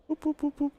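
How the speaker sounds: playful high whoops for each mouse jerk; high whoop sound effect. Acted. Showing viewers the mouse speed, he chirps high whoops.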